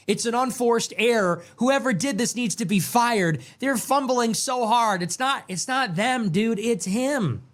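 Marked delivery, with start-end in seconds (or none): 0.1-5.0 high, mocking whine of a Trump supporter. Commentator mimicking Trump supporters' excuses, pitched up and mocking. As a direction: parody the excuse-makers, pitched up. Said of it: "all the Trump supporters can say is".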